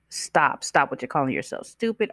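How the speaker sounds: ordinary talk, nothing marked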